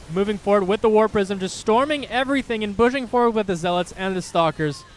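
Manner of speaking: loud, high-pitched esports commentary; excited play-by-play. Real, not acted. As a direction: call the fight as it peaks, voice climbing. Live play-by-play.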